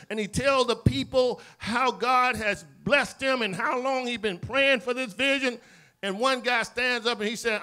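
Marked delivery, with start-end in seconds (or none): none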